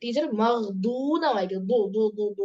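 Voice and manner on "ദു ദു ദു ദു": ദു repeated heavy and full-mouthed; heavy dad drill. Shown as a demonstration. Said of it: "ഇങ്ങനെ".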